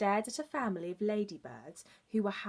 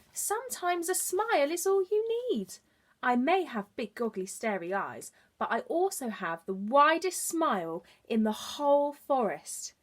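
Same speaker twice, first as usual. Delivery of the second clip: higher, bright-eyed friendly frog; cheerful frog; 5 semitones higher, 5 dB louder than usual. Acted. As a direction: share the secret with a happy lilt. Frog shares his wisdom.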